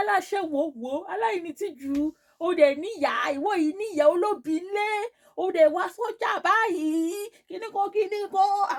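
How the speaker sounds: high, loud hurled insults; imitating a shrill, wailing tirade; retold screaming. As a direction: screech abuse like an enraged market seller. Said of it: "She started screaming at me".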